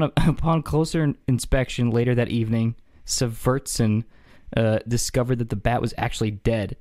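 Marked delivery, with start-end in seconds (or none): none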